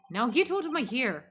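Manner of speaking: put-on Minnesotan governor; Minnesotan accent. Governor dismisses the visitors, put-on Minnesotan accent. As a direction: shoo them off in mock Minnesotan. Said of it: "I'll just make him Minnesotan".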